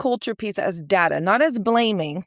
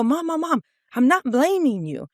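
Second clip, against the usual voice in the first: raised pitch, retold placating interruption; 6 semitones higher than usual. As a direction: cut in to reassure a defensive mom. Retold cutting in to placate mom.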